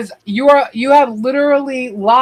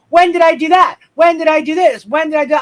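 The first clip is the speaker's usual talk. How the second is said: mocking her denial; 5 semitones higher, 4 dB louder than usual. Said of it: "she's like".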